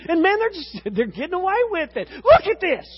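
high, loud, laughing disbelief; rising incredulous protest; exasperated complaint. Retold or imitated. Psalmist complaining that the wicked get away with it. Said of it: "What he's saying is".

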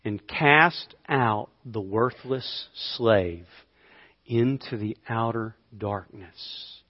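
slow, low, weighty verse reading; solemn scripture reading. Acted. Preacher reads the parable's verse to congregation, slow and grave. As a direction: let each phrase sink in, softening toward the end.

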